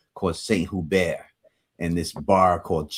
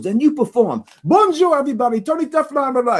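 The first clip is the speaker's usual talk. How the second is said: very high, loud French announcer impression; hammed-up French accent, hollering an introduction; 6 dB louder than usual. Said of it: "he was in his French accent".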